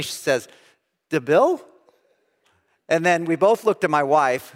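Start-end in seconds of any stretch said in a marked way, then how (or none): 1.1-1.6 questioning repeat of an odd name; puzzled echo. Retold or imitated. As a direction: echo it back as a baffled question. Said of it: "looks at me kind of strangely".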